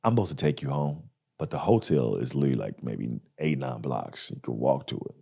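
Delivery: low, soft, hesitant mumble; sheepish limo driver. Retold or imitated. Limo driver sheepishly telling the comic to walk, low and soft. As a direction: awkwardly dodge the drive home. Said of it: "He comes up to me and goes".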